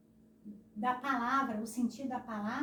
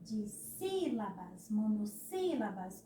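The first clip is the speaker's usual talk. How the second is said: dissílabas, monossílabas with a long, raised SÍ; força no acento; slower than usual. Shown as a demonstration. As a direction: lean slowly on SÍ in dissílabas and monossílabas. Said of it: "olha o acentinho". (dissílabas, monossílabas, com força no SÍ)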